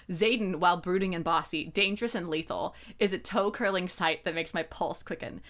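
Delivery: low, measured narration; book passage reading. A narrator's reading. Reading how she talks about Xaden, measured narration.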